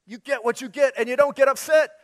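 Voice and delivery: put-on high, loud sing-song child voice; TV-show catchphrase. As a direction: chant it like a kid's show.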